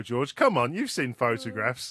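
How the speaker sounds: ordinary delivery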